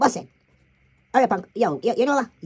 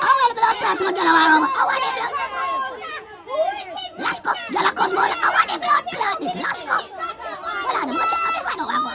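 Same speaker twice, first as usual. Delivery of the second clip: shouting; 9 semitones higher than usual. Acted. Spooky shouting over to the others about the gyal and Lasco. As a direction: holler it to someone far off.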